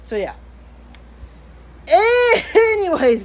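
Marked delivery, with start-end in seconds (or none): 1.9-3.2 laughing. Real, not acted.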